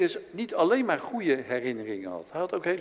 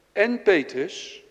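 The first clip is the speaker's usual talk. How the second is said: "Petrus" slow, higher and louder, lingered on; nadruk op Petrus; 6 semitones higher, 5 dB louder, much slower than usual. Shown as a demonstration. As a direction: lean into the word "Petrus". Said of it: "en dat vind ik zo prachtig". ("Petrus" langzaam, hoger en luider uitgesproken)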